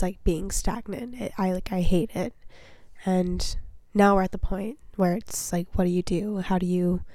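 usual speaking voice

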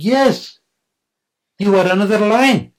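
loud and high, proclaiming; a loud, joyful lion's proclamation; booming declaration. Acted. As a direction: announce it big and loud. Lion declares loudly.

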